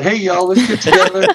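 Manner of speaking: lightly put-on Southern accent, a bit lifted; mock Southern invitation to the gang. Woman inviting friends out, mock Southern accent. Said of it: "I'm using the Southern accent".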